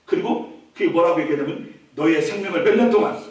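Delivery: normal talking voice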